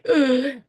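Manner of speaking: sharp loud gasp of fear. Retold or imitated. Therapist recalling her first abuse report, sharp gasp. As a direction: gasp as if startled by dread.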